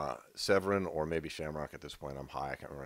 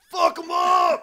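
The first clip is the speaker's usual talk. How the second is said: very high, loud fan holler; drunken full-lung yell; 9 dB louder, much slower than usual. Retold or imitated. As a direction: imitate a drunk heckler at full volume. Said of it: "at the top of his lungs".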